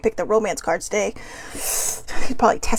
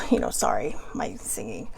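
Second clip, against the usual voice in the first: whispered aside; 14 semitones lower, 8 dB quieter than usual. Real, not acted. Embarrassed aside after singing.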